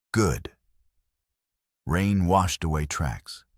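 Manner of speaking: plain reading voice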